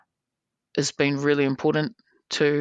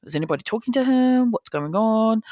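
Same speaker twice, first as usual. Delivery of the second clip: higher, incredulous asking; mock concerned disbelief; 7 semitones higher than usual. Organiser wondering about the angry player, higher worried disbelief. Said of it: "And I'm like".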